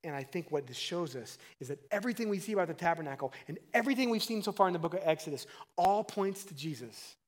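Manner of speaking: normal talking voice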